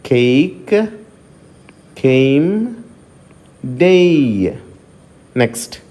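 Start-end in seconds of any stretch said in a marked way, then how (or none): 0.1-4.5 "కేక్, కేమ్, డేయ్" with a gliding ei vowel; diphthong demo. Shown as a demonstration. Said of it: "phonetic transcription చూద్దాము". ("కేక్, కేమ్, డేయ్" లో ఏయ్ ధ్వనిని జారుతూ పలకండి)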